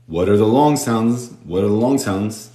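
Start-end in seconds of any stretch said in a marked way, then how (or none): none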